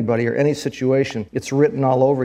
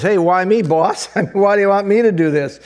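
raised pitch, louder, lightly acted complaint; protesting employee; 7 semitones higher, 5 dB louder than usual. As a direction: object to the boss, higher and louder.

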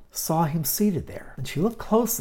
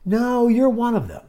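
loud accusation; 9 semitones higher, 6 dB louder than usual. Acted. Another accuses Peter.

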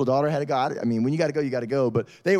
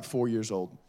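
usual speaking voice

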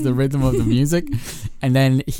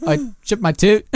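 put-on high-pitched northern accent; put-on northern accent, lifting at the end; 8 semitones higher than usual. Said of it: "the accent you're putting on".

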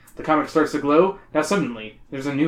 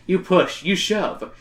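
ordinary talk

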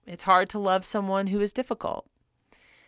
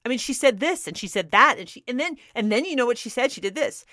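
fast, higher, indignant retold rant; a lively retold rant, pitched up and quick; 6 semitones higher, 3 dB louder than usual. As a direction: protest in a rush. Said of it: "And I ranted for a while longer".

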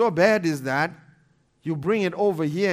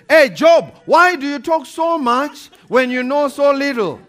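God from the whirlwind, much louder and higher, booming; God's thundering rebuke; 8 semitones higher, 9 dB louder than usual. Acted. God rebukes Job from the whirlwind, booming loud. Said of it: "when God came out of, in a whirlwind".

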